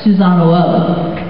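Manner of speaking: plain reading voice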